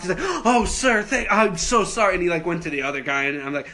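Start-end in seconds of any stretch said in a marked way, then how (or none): 0.4-2.1 higher, louder imitation of a flustered customer; mock a startled, apologetic man; shocked apology. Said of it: "he was, like, shocked".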